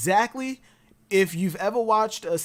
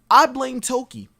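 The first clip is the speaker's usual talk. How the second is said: emphatic; 5 semitones higher, 6 dB louder than usual. Real, not acted. Joking declaration, then apology.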